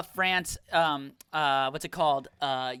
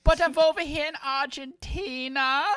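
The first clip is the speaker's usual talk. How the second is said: high-pitched mock German accent; hammed-up German accent, pitched up; 5 semitones higher than usual. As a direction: put on a German villain accent. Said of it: "Nazi guy recording podcasts in Argentina".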